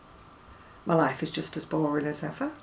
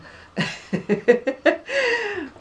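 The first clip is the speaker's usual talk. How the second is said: high burst of laughter; 4 dB louder than usual. Real, not acted. Laugh before signing off.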